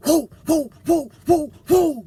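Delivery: high, loud whoops; whooping like passing trucks. Retold or imitated. Trucker mimics trucks racing downhill with loud whoops. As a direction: whoop as the heavy rigs blow by.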